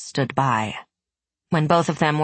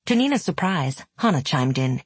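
ordinary delivery

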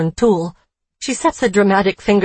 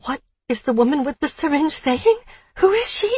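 ordinary delivery

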